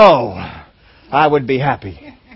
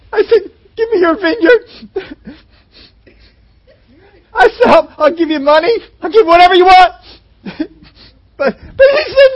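high, loud, hammed-up crybaby whine; whiny king; 9 semitones higher, 7 dB louder than usual. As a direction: whine like a spoiled child denied a toy. King Ahab sulks over vineyard.

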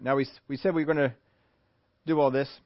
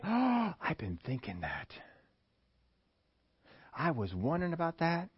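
hushed eager agreement; 2 semitones higher, 9 dB quieter than usual. Acted. Hooked listener agrees, hushed.

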